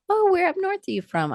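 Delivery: high-pitched put-on questioning voice; imitating curious students. Students quizzing her non-Southern accent.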